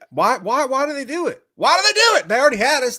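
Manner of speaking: loud, very high-pitched protest; incredulous, rising outcry; exasperated outburst. Real, not acted. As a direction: wail it in exasperation. Exasperated rant about a trailer spoiler.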